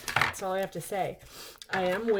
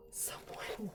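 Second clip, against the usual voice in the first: shocked whisper; 3 semitones higher, 14 dB quieter than usual. Real, not acted. Discovers damaged book.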